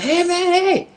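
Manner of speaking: high, loud holler; calling out. Retold or imitated. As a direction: holler to stop someone getting away. Calling out to the CD seller.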